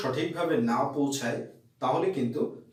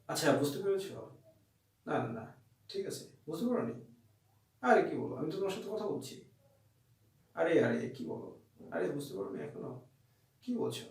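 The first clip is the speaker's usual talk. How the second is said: much softer, words swallowed and half-whispered; mumbled, unclear talk; 8 dB quieter than usual. Shown as a demonstration. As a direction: mumble it so the listener can't follow. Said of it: "তুমি যদি এইভাবে কথা বলো". (অস্পষ্ট, নিচু স্বরে বিড়বিড় করে বলা)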